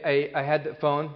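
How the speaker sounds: ordinary talk, nothing marked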